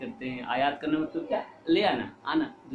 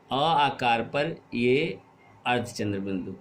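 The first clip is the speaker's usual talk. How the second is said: ordinary talk, nothing marked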